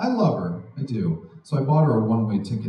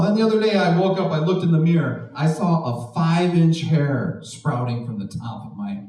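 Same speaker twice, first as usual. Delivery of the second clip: normal talking voice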